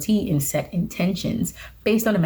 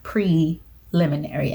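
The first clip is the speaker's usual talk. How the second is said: preliminary drawn out slowly, syllable by syllable; slower than usual. Shown as a demonstration. Careful about a word she usually garbles, slowly. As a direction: ease through preliminary slowly so it comes out right. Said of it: "So I just have to say it slow".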